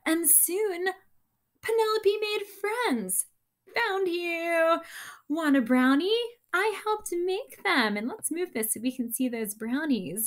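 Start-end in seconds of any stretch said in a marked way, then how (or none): 3.8-8.0 high, bright offer; cheerful offer. Acted. Penelope offering brownies to her new friends cheerfully. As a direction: offer the treat eagerly.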